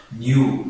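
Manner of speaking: نیو said as nyoo with a clear y glide; pronounce new. Shown as a demonstration. Teacher showing Tajik students the word نیو, clear nyoo. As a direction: stress the yoo in نیو.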